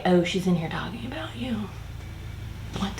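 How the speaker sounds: hushed, gossipy whisper; mock whisper. Mocking how coworkers supposedly gossip, in a hushed whisper. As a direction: whisper it like sneaky office gossip.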